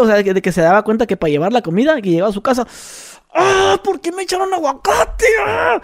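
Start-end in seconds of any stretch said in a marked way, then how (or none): 3.3-5.8 shrieking much higher, mock outrage; hammed-up higher furious shriek; imitating a furious yell. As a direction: play the wife screaming about the avocado. Wife enraged at avocado.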